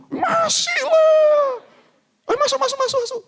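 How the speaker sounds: high, loud, drawn-out glad shout; overjoyed mother. Retold or imitated. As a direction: burst out welcoming your son. Excited mother at the door. (ibu girang berteriak menyambut anaknya)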